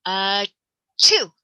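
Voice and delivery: loud "chew" like a pretend sneeze; mock sneeze. Shown as a demonstration. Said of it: "pretend that they are sneezing".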